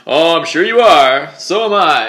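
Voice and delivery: loud, drawn-out fake on-air charm; mock TV host banter. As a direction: parody a male anchor's forced banter. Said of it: "And then he's like".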